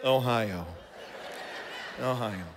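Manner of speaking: crowd's low Ohio. Retold or imitated. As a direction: drop the pitch low on Ohio. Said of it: "did you hear how everybody said it".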